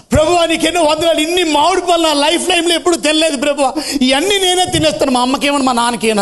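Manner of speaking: put-on high, eager boy's voice; overjoyed child. A narrator's reading. Boy gloats over his mangoes, high and eager. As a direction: gush like a greedy happy kid. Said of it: "ఆనందానికి ఆడికి హద్దులు లేవు".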